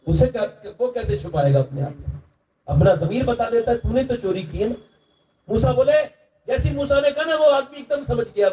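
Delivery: high, impassioned pleading sermon; preacher pleading with the young men; preaching at full force. Acted. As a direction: build the crowd toward repentance. Preacher retelling with fervour.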